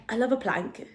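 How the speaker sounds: soft try at a Welsh lilt; amateur Welsh accent, tried out tentatively; attempted Welsh accent. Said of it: "she's from Wales, and she's like".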